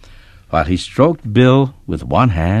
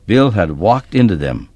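plain reading voice

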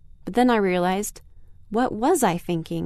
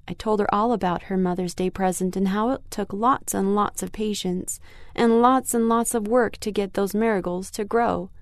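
usual speaking voice

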